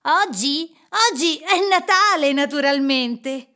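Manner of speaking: put-on loud, high boyish voice; boy calling back. A narrator's reading. Boy tells Scrooge the day, loud and astonished.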